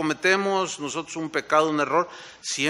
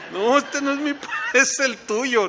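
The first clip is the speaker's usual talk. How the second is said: higher, laughing denial; laughing retort; 9 semitones higher than usual. Retold or imitated. Moses telling God they are His people, laughing and higher. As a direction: toss it back with a laugh.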